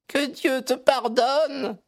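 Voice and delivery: high, drawn-out dramatic exclamation; crying out. A narrator's reading. Mother appalled at her daughter's admission, crying out. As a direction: cry it out high and slow, appalled. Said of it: "s'est écriée madame Charpentier".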